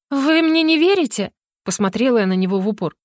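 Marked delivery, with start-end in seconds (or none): 0.1-1.3 high, indignant disbelief; indignant question. A narrator's reading. Heroine to the skeptical mage, high indignant question. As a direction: ask as if wounded by doubt.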